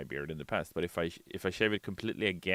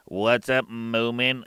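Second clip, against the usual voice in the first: ordinary talk, nothing marked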